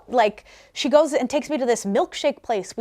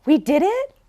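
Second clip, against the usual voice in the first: excited disbelief; 4 semitones higher, 4 dB louder than usual. Retold or imitated. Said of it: "I watched her get so excited".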